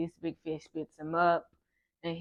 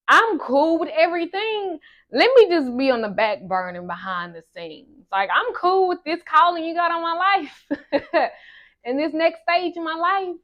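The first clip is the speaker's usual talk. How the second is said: loud, high mock plea with a laugh breaking in; high-pitched bargaining; 10 semitones higher, 7 dB louder than usual. Student bargaining with God over her calling, high-pitched mock plea. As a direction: plead your case high and loud, half laughing.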